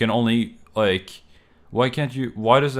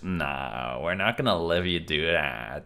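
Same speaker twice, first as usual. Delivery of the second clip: lower, mock-official refusal; mock smug computer; 5 semitones lower than usual. Computer mockingly refusing the user, lower and smug. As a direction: voice the system as a smug gatekeeper. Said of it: "it's just like".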